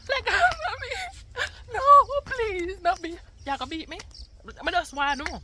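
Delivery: high, loud mock plea with a laugh; playful fake pleading, squeaky and loud; mock begging. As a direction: ham up begging not to be beaten. Mock pleading to camera about beating.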